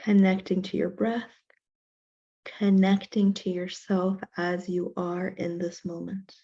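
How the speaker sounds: slow, measured meditation voice with long pauses; guided meditation. Real, not acted. Teacher leading a class in self-compassion breathing, guided meditation. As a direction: count the breath out evenly, unhurried, guiding them into stillness.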